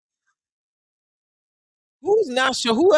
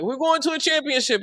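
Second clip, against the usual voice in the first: higher-pitched imitation of overexcited fans; mock fan hype; 7 semitones higher than usual. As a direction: imitate fans getting carried away. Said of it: "we be like".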